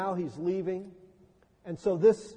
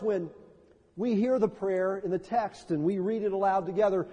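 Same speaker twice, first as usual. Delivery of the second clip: ordinary talk, nothing marked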